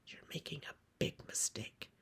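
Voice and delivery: quick, very soft whisper; hushed, voiceless caution; whispered warning. Acted. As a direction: warn her under the breath. Farm woman warns girl in secret.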